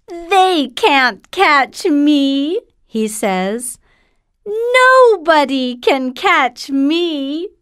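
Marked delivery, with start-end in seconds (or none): 0.1-2.6 gingerbread man voice. Acted. 4.5-7.5 gingerbread man voice. Acted.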